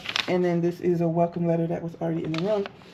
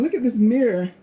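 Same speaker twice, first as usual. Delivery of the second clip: ordinary talk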